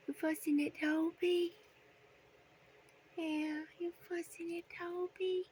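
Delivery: high sing-song cooing to a pet bird; high, playful pet talk. Real, not acted. Baby talk to parrots.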